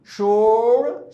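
शोर held very slowly, the श stretched out; long drawn sh. Shown as a demonstration. Teacher modelling the sound for the class. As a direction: hold the श of शोर for a long time. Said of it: "वहीं से ये sound निकलता है".

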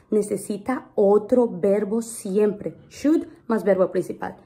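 normal talking voice

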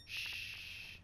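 quiet, drawn-out shh; soft shush. Real, not acted. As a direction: hush yourself gently. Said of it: "Back to being quiet".

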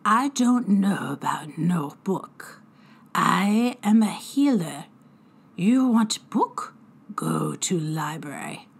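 slow, strange hag voice, trailing to a whisper; wary drunken fairy dodging the question; broken-English fairy hag. Acted. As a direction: play dumb as a crafty old sprite. Fairy healer voice.

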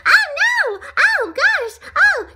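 high, loud panicked cries; frightened. A narrator's reading. Donut sick and frightened, high loud panic. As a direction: cry out in squealing panic. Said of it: "He felt sick and frightened".

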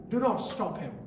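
loud, impassioned prayer; fervent prayer. Acted. Preacher leading the church in a fervent closing prayer. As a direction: pray aloud with full conviction.

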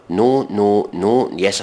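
ordinary talk, nothing marked

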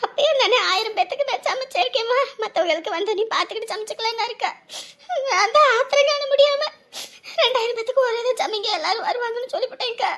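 high-pitched weepy complaint; acted plaintive, crying voice; tearful lament. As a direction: blame yourself through tears. Host laments wasted food, in tears.

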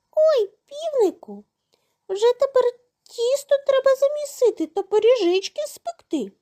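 high-pitched mouse voice; delighted little mice. A narrator's reading. Mice cheer the rooster's flour, high and squeaky. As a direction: squeak gladly as small mice.

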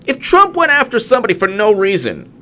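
high, loud indignant shouting; outraged rant. Real, not acted. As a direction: blast the hypocrisy. Ranting in outrage.

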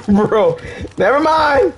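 high, loud exclamation; loud outburst. Real, not acted. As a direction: blurt it out loud. Reacting loudly in game.

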